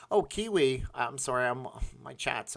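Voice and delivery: usual speaking voice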